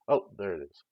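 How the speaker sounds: sudden loud exclamation into the mic; loud outburst. Real, not acted. Streamer spots the program finally responding, loud exclamation. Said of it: "might have been a little loud. Kinda yelled at the mic".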